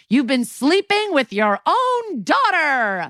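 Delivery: high, slow shouted reveal; hammed-up soap-opera outburst; mock dramatic shout. Mom telling the teacher the truth, mock shouting.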